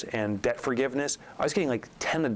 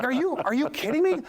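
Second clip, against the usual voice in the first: high, stunned disbelief; can't believe what he just heard; incredulous. Retold or imitated. Retelling disbelief at accountant's joke.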